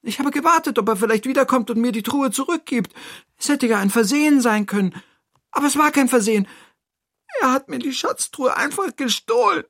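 high, quick, distressed character voice; upset Toni. Acted. Toni tells the friend about the stolen chest, high and upset. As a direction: pour out the grievance in a high voice.

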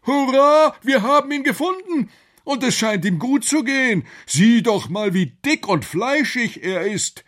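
jubilant high, loud professor voice; thrilled scientist at a great discovery; enthusiastic exclamation. Acted. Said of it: "rief Professor Quakenbrück begeistert aus".

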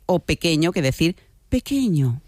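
normal talking voice

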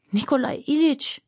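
high, pleading groan; anguished high-pitched appeal; moaned. A narrator's reading. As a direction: moan the name in dismay. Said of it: "stöhnte Aljoscha".

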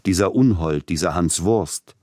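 ordinary delivery, nothing marked

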